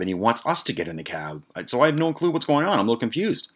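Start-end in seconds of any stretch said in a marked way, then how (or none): none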